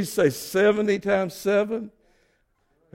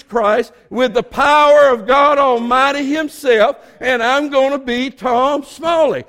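high, emphatic preacher's declaration; raised pulpit voice, stretching the big phrases; forceful preaching; 4 semitones higher, 3 dB louder than usual. Acted. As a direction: proclaim it with conviction, leaning on key words. Preaches a personal declaration at full force.

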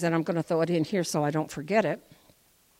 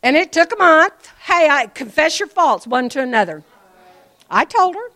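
excited testimony; 8 semitones higher, 9 dB louder than usual. Real, not acted.

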